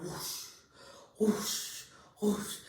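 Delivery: breathy whooshing sound effect; whoosh, whoosh, like something sloshing over; imitated whoosh. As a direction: make a soft swooshing noise with the mouth. Imitates placenta sound when rolling over.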